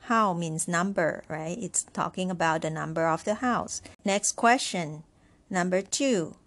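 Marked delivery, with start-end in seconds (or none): none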